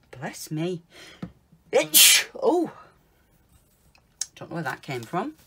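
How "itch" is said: a real, sudden sneeze; loud sneeze. Real, not acted. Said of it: "Oh, gonna sneeze".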